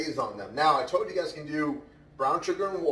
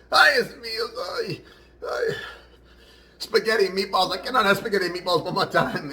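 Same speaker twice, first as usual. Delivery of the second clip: raised, loud cry of mock despair; exasperated Italian guy; 6 semitones higher, 4 dB louder than usual. Italian guy opens his lunch on the roof, mock despair. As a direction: play a fed-up Italian in a joke. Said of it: "The Italian guy opens his lunch and goes".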